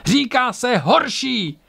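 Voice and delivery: high, drawn-out yelled retort; shouting. A narrator's reading. Tora corrects Ang mid-battle, shouting back. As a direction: snap back, stretching words up high. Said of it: "křikla Tora".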